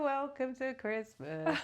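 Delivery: slow festive greeting. Pretend.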